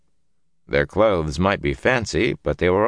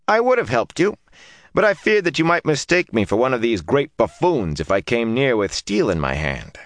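role; 6 semitones higher than usual. A narrator's reading. Woman explaining herself.

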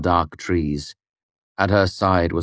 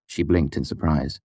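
plain reading voice

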